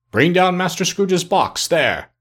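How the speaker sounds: slow, high-pitched calling order; terrible voice. A narrator's reading. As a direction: call it down the hall, drawn out and commanding. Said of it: "A terrible voice in the hall cried".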